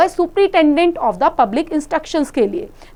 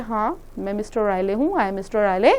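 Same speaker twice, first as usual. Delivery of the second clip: quiet repeat; 4 semitones lower, 6 dB quieter than usual. Shown as a demonstration. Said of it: "explains Riley's reply quietly".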